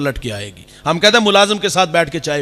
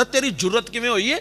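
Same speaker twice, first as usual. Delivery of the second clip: scornful, raised brother's rebuke; mock angry brother; 6 semitones higher than usual. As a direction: scold her with contempt. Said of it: "بھائی کے تیور دیکھنے والے".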